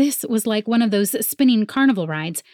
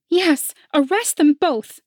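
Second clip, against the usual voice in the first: shrill rival voice; 9 semitones higher, 4 dB louder, slower than usual. A narrator's reading. Rival cheers arrest on.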